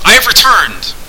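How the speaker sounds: loud call through the house. A narrator's reading. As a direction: call the name to a far room. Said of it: "he called".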